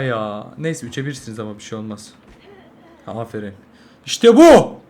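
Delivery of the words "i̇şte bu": excited cheer. Real, not acted. After good kill.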